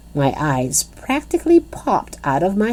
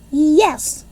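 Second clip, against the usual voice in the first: yelled; 11 semitones higher than usual. A narrator's reading. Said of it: "I yelled".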